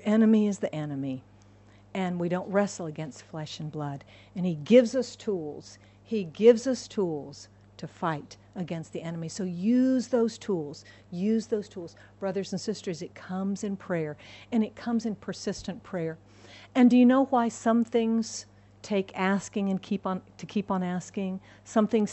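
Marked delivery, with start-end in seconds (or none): none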